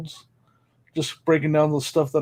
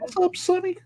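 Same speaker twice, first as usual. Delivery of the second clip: high goofy voice; 5 dB quieter than usual. Acted. Greeting chat in put-on voice.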